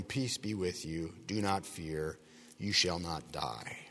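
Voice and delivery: low, soft, reassuring; calm reassurance. A narrator's reading. The Lord reassures a fearful Gideon, calm and soft.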